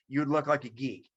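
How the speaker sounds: ordinary talk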